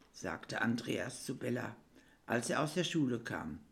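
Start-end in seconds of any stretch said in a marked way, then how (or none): none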